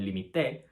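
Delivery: límite stressed as li-mi-TE; final-syllable stress. Shown as a demonstration. Teacher contrasting three forms, límite as li-mi-TE. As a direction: land on TE at the end of límite. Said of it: "which all mean different things".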